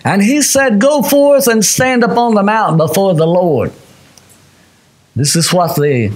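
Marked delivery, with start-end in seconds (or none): 0.0-3.7 high, ringing scripture proclamation; raised, sustained pulpit voice; preacher's scripture reading. Real, not acted. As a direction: proclaim the verse to the congregation. Reads scripture aloud to congregation.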